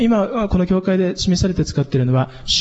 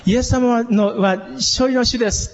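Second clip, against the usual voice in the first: high, emphatic preaching proclamation; preaching force; 7 semitones higher, slower than usual. Shown as a demonstration. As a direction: proclaim it like an evangelistic sermon. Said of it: "っていう感じになってね".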